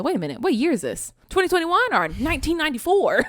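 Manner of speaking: incredulous, pitch climbing on the years; mock shocked at the dated look; retold disbelief. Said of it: "I said".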